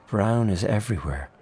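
usual speaking voice

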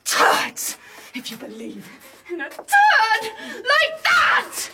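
bitter rant rising to a high scream; hysterical anger. Acted. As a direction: let despair build into a final shriek. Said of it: "Calm down, calm down".

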